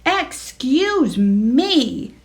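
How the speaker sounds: slow, stern excuse me. Acted. Henry silences the farmyard, slow stern. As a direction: cut them off sternly. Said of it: "said Henry sternly".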